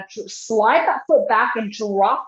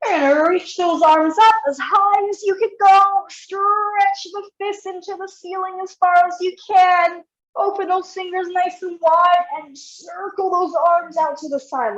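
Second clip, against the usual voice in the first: energetic coaching; 6 semitones higher than usual. Real, not acted. Coach cueing a big stretch.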